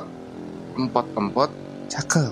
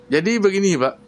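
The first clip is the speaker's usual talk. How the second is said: a hint of the father's voice, a little higher; talking like the old man; 6 semitones higher than usual. Son drifts into the old man's voice. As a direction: slip into Bapak's way of speaking. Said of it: "kok saya jadi kayak Bapak ngomongnya".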